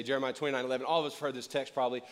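ordinary talk

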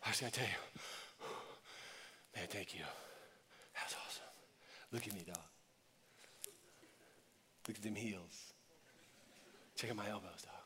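the grateful one returning alone, speaking very softly; hushed thanks. Retold or imitated. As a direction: murmur your thanks to Jesus.

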